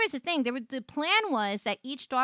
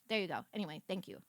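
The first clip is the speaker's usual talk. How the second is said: normal talking voice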